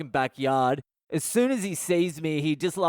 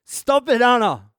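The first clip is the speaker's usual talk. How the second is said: high, loud panicked yell; screaming; 9 semitones higher, 10 dB louder than usual. Retold or imitated. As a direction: scream at a mate to back off. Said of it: "I'm screaming".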